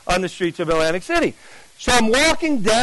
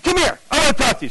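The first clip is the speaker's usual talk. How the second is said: high, insistent command; urgent summons; 7 semitones higher, faster than usual. Retold or imitated. Seeker grabs the evangelist to talk, urgent summons. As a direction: pull him in with a high, eager voice.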